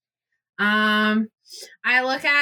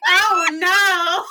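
laughing dismay; 9 semitones higher than usual. Retold or imitated. Player recalling her reaction to Frankenstein, laughing dismay. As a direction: wail it in amused horror, laughing. Laughing horror at introduced wife.

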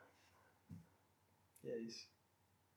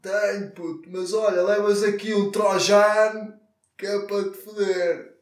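high, loud, slow shouted hustler; pirate from Bahia; 7 semitones higher, 10 dB louder than usual. Retold or imitated. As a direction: call out like a street pirate. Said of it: "E ele vai dizer".

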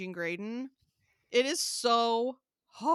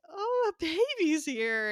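put-on toddler voice; 8 semitones higher than usual. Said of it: "he was like".

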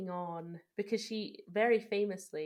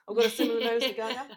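playful brag; 4 semitones higher, 11 dB louder, faster than usual. Real, not acted. Bragging jokingly about nose.

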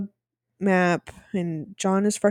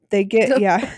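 ordinary delivery

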